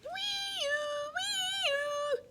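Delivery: high, steady siren call; siren wail. A narrator's reading. Siren imitation.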